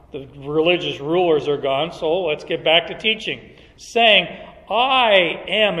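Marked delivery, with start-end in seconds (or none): 4.7-5.8 "I am" said higher and firmer; emphatic I am. Shown as a demonstration. Teacher showing the emphatic "I am" to the class. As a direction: land "I am" with weight.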